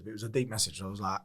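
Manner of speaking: ordinary talk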